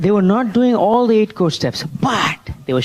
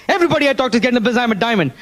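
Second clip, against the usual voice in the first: very fast and higher, heated; angry repeat; 7 semitones higher, much faster than usual. Retold or imitated. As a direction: rattle it off in determined anger. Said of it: "Now I was really angry".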